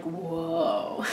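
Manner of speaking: soft, breathy-laughing whoa of wonder; hushed whoa. Retold or imitated. Staring at her own moving belly, a quiet laughing whoa. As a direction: murmur it in quiet amazement. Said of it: "And I'm like".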